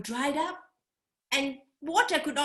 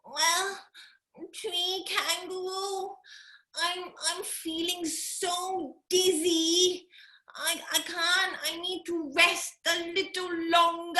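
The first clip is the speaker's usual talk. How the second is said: faking dizziness; 6 semitones higher than usual. Acted.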